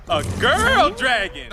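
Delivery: high, drawn-out astonishment; surprised cry swooping up and falling; startled realization. Acted. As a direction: stretch it out as the discovery lands. Sudden realization about the dragon.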